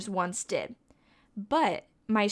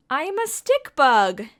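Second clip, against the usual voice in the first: louder, lifted announcement; excited reveal; 4 semitones higher, 8 dB louder than usual. Pretend. Teacher revealing the bug to children, louder and excited. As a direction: proudly announce the answer.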